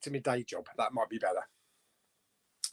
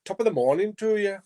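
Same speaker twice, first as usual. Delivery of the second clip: higher amateur stage-Irish voice; Irish accent; 4 semitones higher than usual. Acted. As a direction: have a go at a cheesy Irish accent. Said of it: "Is that Irish?".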